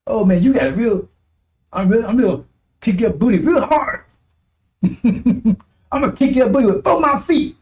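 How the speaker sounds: feeble threat, climbing high and slowing; hammed-up, silly threat rising in pitch; mock fury. Preacher parodying too-polite language of anger, mock-furious. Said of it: "What do you think the language gonna be like?".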